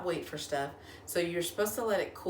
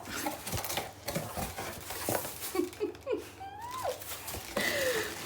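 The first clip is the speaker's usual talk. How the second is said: high laughing fit; 4 dB quieter than usual. Real, not acted. Laughing at the poncho.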